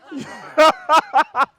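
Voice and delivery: high, loud burst of laughter; big laughing fit. Real, not acted. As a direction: crack up at your own impression. Laughs at own children impression.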